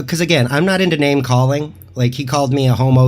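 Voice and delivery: ordinary delivery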